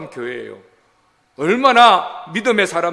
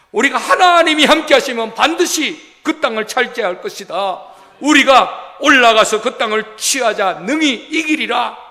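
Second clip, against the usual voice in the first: loud, emphatic proclamation to a crowd; rally frightened people with full conviction; forceful preacher voicing a bold call; rallying cry; 4 semitones higher, 5 dB louder than usual. Acting out Joshua and Caleb rallying Israel.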